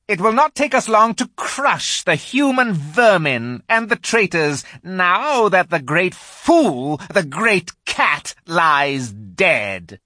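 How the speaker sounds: high, loud, slow triumphant call; rally the army like a cruel queen; witch calling out. A narrator's reading. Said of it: "the voice of the witch calling out".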